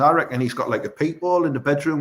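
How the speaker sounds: normal talking voice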